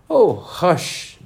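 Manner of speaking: high, urgent hushing; frightened hush. A narrator's reading. Rabbit hushes laughing Alice, frightened. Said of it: "whispered in a frightened tone".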